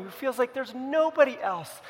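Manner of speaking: ordinary talk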